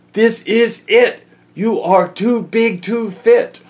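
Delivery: high, slow mock shout. Reader shouting at the oversized moose in a high voice. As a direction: shout it like the moose won't fit. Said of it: "Would you shout".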